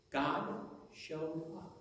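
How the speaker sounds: soft and very slow; slow, understated emphasis; hushed, deliberate reveal. A narrator's reading. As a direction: let each word land quietly, at half speed. Reveal repeated slowly for effect.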